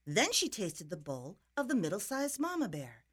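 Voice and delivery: usual speaking voice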